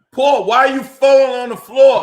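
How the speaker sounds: high, loud frustrated shout at the screen; exasperated yell. Real, not acted. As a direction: yell at the fighter in disbelief. Yelling at fighter on stream.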